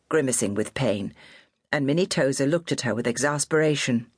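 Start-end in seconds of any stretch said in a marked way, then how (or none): none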